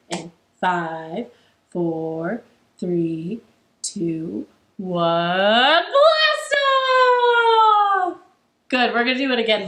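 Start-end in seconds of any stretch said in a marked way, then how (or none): 0.1-5.8 low-pitched, spaced-out countdown. Real, not acted. Countdown before blast-off in song. 5.8-8.2 very high, loud launch whoop; excited shout. Real, not acted. Shouted end of rocket song.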